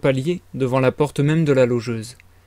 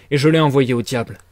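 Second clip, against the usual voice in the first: ordinary delivery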